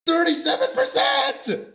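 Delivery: high, laughing triumphant shout; excited shout. Real, not acted. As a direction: cheer it out, laughing with joy. New best run reached.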